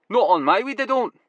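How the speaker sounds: grim Glaswegian; Glasgow accent. Acted. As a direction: Glaswegian grower protecting his weed. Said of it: "his Glasgow accent now going full throttle".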